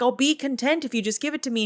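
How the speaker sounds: queen demanding, fast and high. Acted. Queen demands her beauty.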